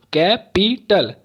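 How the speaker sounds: "Capital" in three beats, कै, पी, टल; syllable by syllable. Shown as a demonstration. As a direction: chop "capital" into three parts. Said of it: "तीन बार देखिए बोलना पड़". ("Capital" को तीन हिस्सों में तोड़कर)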